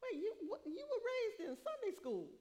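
high, startled "what?" at her grown son; mother reacting in disbelief to her son, surprised; shocked mother. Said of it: "I was like".

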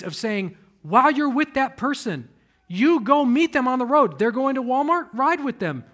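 loud, high preaching build; full-force preaching. Acted. Preacher urging church to reconcile, loud preaching. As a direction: drive it home to the congregation.

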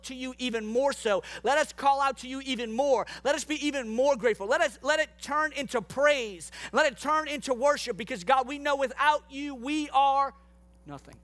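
heartfelt prayer voice, lifted toward God. Real, not acted. Pastor praying aloud over the congregation, earnest prayer. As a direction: pray it out over the room, full of gratitude.

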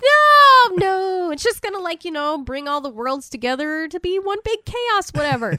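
lightly voiced devil denying; mock denial. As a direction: protest innocence hammily. Satan denying, retold.

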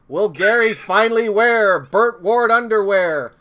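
dramatic announcer outro for the podcast episode; announcer teaser. Pretend. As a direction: tease the next episode like an old serial narrator. Cliffhanger outro in announcer style.

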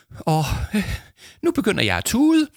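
very high, overcome teacher voice; sniffling, tearful. A narrator's reading. Teacher moved by the boys' video, sniffling. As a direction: squeak it out on the verge of tears. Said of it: "snøftede Kirsten".

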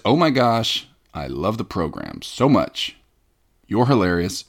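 ordinary delivery

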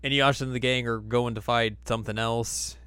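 normal talking voice

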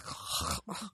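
pig-like throaty snort; snorted R noise. Shown as a demonstration. Warning listeners of her bad French R, throaty snort. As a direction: snort in place of a rolled R. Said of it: "you're going to get more of a".

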